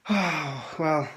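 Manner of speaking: normal talking voice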